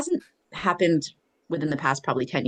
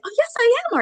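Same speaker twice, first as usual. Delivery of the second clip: bright and much higher, a touch louder; mock eager yes; eager answer; 5 dB louder, faster than usual. Retelling answering the nail technician, mock eager.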